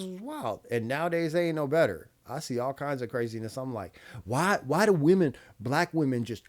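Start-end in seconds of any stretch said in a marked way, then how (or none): 4.2-6.4 exasperated complaint. Retold or imitated. Exasperated quote of own reaction.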